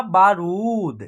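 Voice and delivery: बारूद with the रू drawn out; long ऊ. Shown as a demonstration. Teacher showing the finished word बारूद, vowel stretched. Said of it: "ये हो गया आपका".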